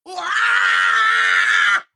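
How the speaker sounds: loud high excited scream; wild shout. Real, not acted. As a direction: let out a crazy happy yell. Excited outburst.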